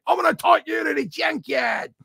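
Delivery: very high, hollered Aussie threat; mock Australian threat. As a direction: bellow it in a hammed-up Australian accent. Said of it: "That's what I remember him saying".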